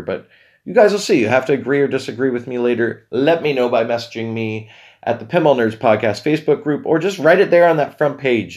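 normal talking voice

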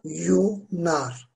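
"Desayunar" slowly, as de-sa-yu-nar with pauses; syllable by syllable. Shown as a demonstration. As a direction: sound out "Desayunar" exactly as written. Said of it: "Aynı yazıldığı gibi okunuyor". ("Desayunar" kelimesini yavaşça hecele)

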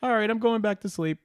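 high-pitched resigned punchline; high comic bedtime voice; acted giving up. Punchline of acted night scene.

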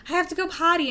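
put-on high, loud little-kid voice; a child urgently saying they need to go; child asking for the potty. Child telling parent they need the potty, high and loud. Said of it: "and they say".

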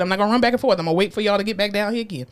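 ordinary talk

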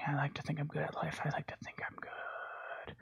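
fading into a whisper. Real, not acted. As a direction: whisper it, trailing off. Self-deprecating repeat, fading out.

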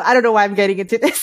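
usual speaking voice

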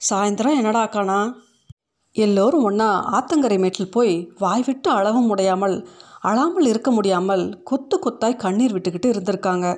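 ordinary delivery

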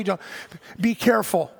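ordinary talk

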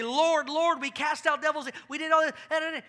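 loud, high begging cry; desperate plea. Retold or imitated. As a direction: beg him to recognize them. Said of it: "they're gonna say".

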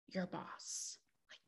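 whispered sarcastic aside. Real, not acted. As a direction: drop to a whisper for the punchline. Sarcastic aside about boss.

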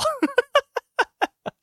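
high, breathy burst of real laughter; sudden amused laugh. Real, not acted. Laughing at a silly booking.

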